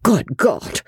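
high, flustered stammer; in a flutter. A narrator's reading. Joss asks the masked stranger's identity, in a flutter. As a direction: stumble over the question in a fluster. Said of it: "asked Joss in a flutter".

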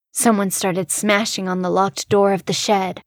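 ordinary delivery, nothing marked

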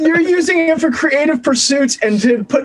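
reassuring. Real, not acted. Reassuring a friend about podcasting.

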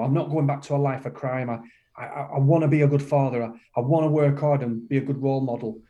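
ordinary delivery

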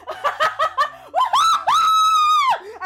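high shouted laugh of delight; gleeful girl shriek. Acted. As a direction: let out a wild squealing laugh while running. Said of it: "she just starts going".

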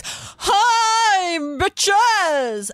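high, drawn-out booming greeting; dad's big greeting. Retold or imitated. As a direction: imitate a towering dad bellowing hello. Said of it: "he goes".

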